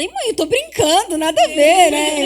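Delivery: put-on high, loud teen protest; the son whining he was joking. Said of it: "Aí ele fala".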